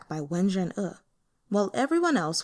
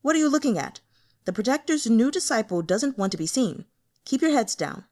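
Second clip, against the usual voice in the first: louder, higher harsh snap at the men; harsher voice; 4 semitones higher, 4 dB louder, faster than usual. Acted. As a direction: cut them off and make them look away. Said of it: "said in a harsher voice".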